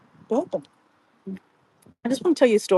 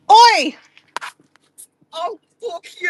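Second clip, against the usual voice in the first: sharp high shout of oi; spotting a friend outside, a loud high call; 10 semitones higher, 5 dB louder, slower than usual. Real, not acted. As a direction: yell oi out the window to a friend. Calling out to friend outside car.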